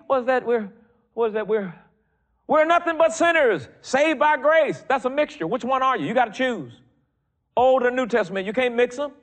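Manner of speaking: high, loud, fervent preaching; full-force preaching. Real, not acted. Preacher rousing the church against mixing covenants, full force. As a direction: preach it hard and high to the congregation.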